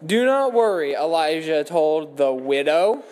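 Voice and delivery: ordinary delivery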